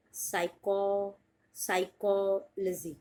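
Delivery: "साइको-, साइकोलॉजी" broken at साइको then joined; slow split then whole word; syllable split. Shown as a demonstration. Teacher to students, slow split of psychology. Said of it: "टू word में आप divide करें".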